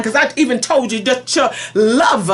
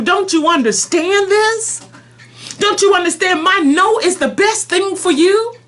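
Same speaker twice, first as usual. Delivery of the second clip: pleading; 6 semitones higher than usual. Acted. Pleading with the bride.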